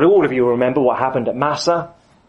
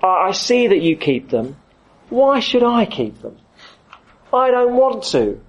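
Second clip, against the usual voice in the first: put-on sulky kid; slower than usual. Kid whining to his dad about keeping the rules. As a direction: play a reluctant teenager pushing back. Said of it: "a kid says to his dad".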